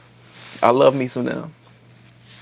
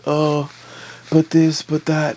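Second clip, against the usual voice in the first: higher mock whine; whining; 5 semitones higher, slower than usual. Whining prince making excuses to her, mockingly. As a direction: imitate his petty complaining, pitched up. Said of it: "He's just whining as he does".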